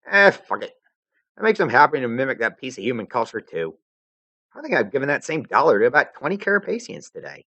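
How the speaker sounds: put-on brash high casual girl; cocky troll girl shrugging; Vriska voice.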